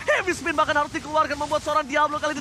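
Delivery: hyped esports play-by-play; loud, stretched caster call; fighting game announcer. Real, not acted.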